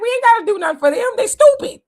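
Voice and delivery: fast, high, loud mocking voice; mocking Democrats. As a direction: sneer it out as the smug politicians. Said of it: "They're like".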